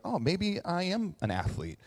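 a quiet, dawning "oh, maybe"; tentative realization. Retold or imitated. Player recalling a coach's encouragement, tentative and soft. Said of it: "made me feel like".

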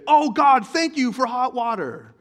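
very loud, high, slow thankful cry; mock gratitude. Grateful person in the shower thanking God, loud and high. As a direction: gush with thanks over hot water.

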